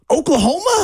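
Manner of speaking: high, incredulous question; mock-baffled echo of the question; retold disbelief. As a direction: throw it back as if it's absurd. Retold disbelief at being asked.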